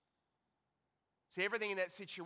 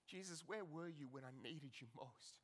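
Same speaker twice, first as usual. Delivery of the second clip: much softer, low, almost to himself; hushed lament; 5 semitones lower, 16 dB quieter than usual. Acted. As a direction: ask it quietly after the outburst. Same question, hushed.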